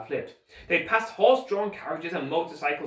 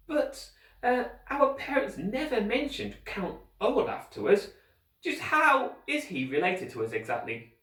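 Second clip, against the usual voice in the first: put-on boy voice; 7 semitones higher than usual. Klaus voiced higher.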